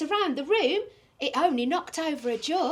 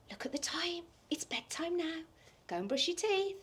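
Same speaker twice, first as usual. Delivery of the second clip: whisper; 10 dB quieter than usual. Acted. Storyteller whispering to the children that it's bedtime. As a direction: gasp then hushed whisper.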